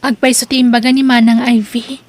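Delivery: loud, firm declaration; forceful declaration. Acted. Edna insists to Denver that Ivy told the truth, loud and firm. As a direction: stand up and speak out.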